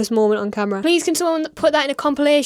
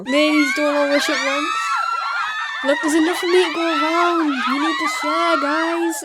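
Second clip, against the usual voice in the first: mock celebrity swagger; 4 semitones higher than usual.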